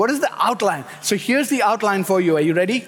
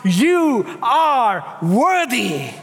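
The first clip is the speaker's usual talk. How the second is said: drawn-out proclamation; 2 semitones higher, much slower than usual. Acted. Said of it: "crying out".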